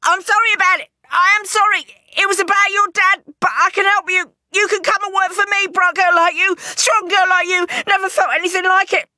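high, loud, frantic bargaining; gabbling plea. Acted. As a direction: babble to save your skin, begging. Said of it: "he's gabbling".

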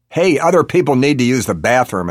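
outraged yell. A narrator's reading. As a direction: yell it through a closed door. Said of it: "an outraged male voice yells".

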